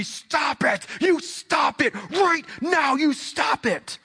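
high, slow, drawn-out shouted command; angry yell. Retold or imitated. Father yelling to stop his daughter, angry shout. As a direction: act out a father yelling at the top of his lungs.

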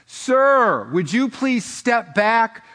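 high, loud, drawn-out mock announcer; imitated insistent announcer. Announcer's third call to the golfer, insistent mock PA voice. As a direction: mimic an announcer losing patience over the PA.

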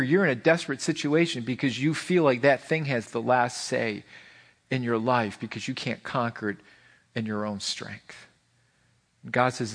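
ordinary delivery

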